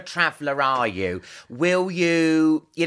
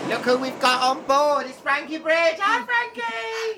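camp air steward; 12 semitones higher than usual. Pretend. Camp flight attendant greeting.

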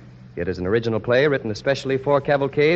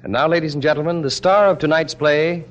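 grand announcer build-up; 3 semitones higher, 3 dB louder than usual. Acted. Announcer introduces the star.

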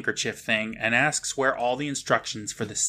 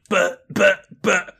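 louder, jeering copy of stammering; taunting imitation with raised pitch; mocking stutter; 7 semitones higher, 5 dB louder, slower than usual. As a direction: throw the stammer back, high and punchy. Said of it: "the rabbit mocks him going".

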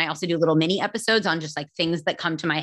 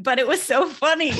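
high, playful inner-voice whine; mock protest; 8 semitones higher than usual. Teasing the host with their inner thought, mock protest. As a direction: plead your case in a high, amused voice.

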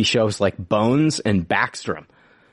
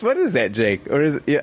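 ordinary talk, nothing marked

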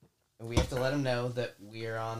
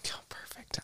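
hushed secret aside; whispering; 11 dB quieter than usual. Pretend. Joking about having a porn star.

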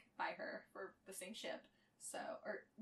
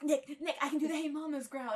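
excited; 6 semitones higher, 12 dB louder than usual. Retold or imitated. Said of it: "I was like".

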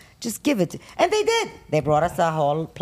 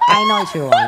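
high squeal; 7 dB louder than usual. Real, not acted. Squealed repeat.

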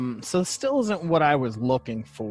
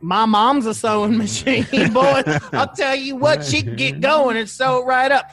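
put-on loud, high New York accent; 11 semitones higher, 6 dB louder than usual. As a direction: brag about mom in a thick New York accent. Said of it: "that was my New York accent".

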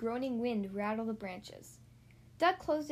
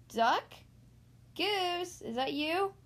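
searching call, higher as it goes on; 5 semitones higher, much slower than usual. A narrator's reading. Swamp monster seeks hidden Duck and Goose, calling out. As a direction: call their names as questions. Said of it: "the swamp monster called".

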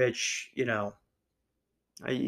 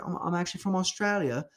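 lightly attempted Australian accent, pitched up; half-baked Aussie accent on a higher voice; terrible Australian accent; 5 semitones higher, faster than usual. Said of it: "That was a terrible Australian accent".

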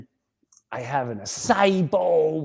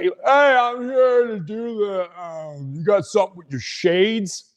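workman at door; 5 semitones higher than usual. Retold or imitated. Imitating the shades guy at the door, calling out.

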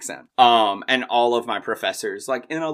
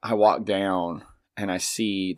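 usual speaking voice

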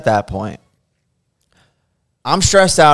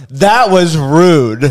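slow, louder line broken with laughter; laughing retold rebuke; 5 semitones higher, 8 dB louder, much slower than usual. Artist quoting the offended client through laughter. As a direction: deliver the rebuke while cracking up.

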